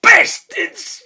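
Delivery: high shrieked curse; goblin shriek. Acted. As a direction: screech it with relish. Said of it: "wiggle the hand when you're doing it".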